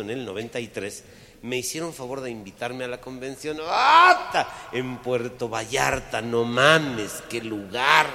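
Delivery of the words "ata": exclaim. Real, not acted. Exclamación fuerte.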